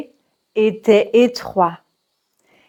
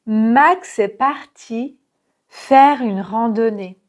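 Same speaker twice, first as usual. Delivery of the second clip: slow, syllable by syllable; slow teacher's model sentence; slow model reading. Shown as a demonstration. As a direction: read it slowly for repetition. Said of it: "Écoutez et répétez".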